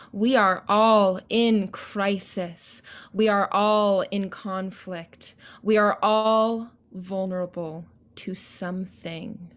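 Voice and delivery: solemn declaration. Acted. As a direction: bless all beings from the stage. Closing declaration and blessing.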